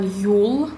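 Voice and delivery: «юл» with a back yu vowel; teacher's demo of hard ю in «юл»; hard-word ю. Shown as a demonstration. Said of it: "в твёрдых словах".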